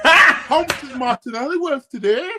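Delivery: high, loud mock question with a laugh; mocking fan voice. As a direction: laugh while parodying a fan. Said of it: "talking like this".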